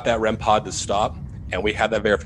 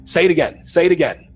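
loud urgent shout, higher pitch; yelling; 6 semitones higher, 7 dB louder, faster than usual. Retold or imitated. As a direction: bark the command to keep going. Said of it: "I just start yelling".

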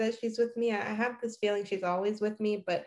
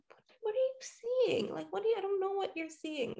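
much higher, softer, puzzled; baffled question; 10 semitones higher, 7 dB quieter, faster than usual. Retold or imitated. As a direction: she cannot see the likeness. Herself puzzled at cousin.

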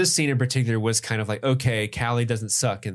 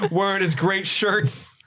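higher-pitched mock wrestling promo; The Rock; 6 semitones higher than usual. Cop threatening someone in third person, wrestler swagger. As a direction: build the threat like a wrestler taunting a rival. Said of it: "He starts talking like The Rock from W W E".

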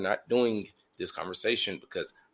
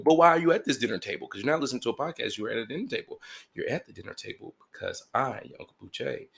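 usual speaking voice

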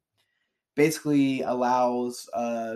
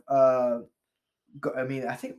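usual speaking voice

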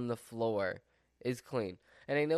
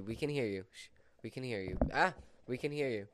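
ordinary delivery

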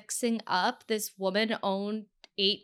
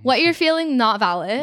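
imitated dismissal; 3 semitones higher, 11 dB louder than usual. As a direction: mimic her shutting him down emphatically. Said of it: "The way she's just like".

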